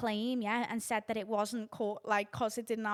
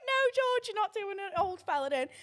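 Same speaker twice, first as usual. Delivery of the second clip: higher, louder through laughter; laughing refusal; 10 semitones higher, 4 dB louder than usual. Retold or imitated. Answering George's joke, a higher laughing refusal. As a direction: laugh him off, amused. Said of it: "laughing reply to George".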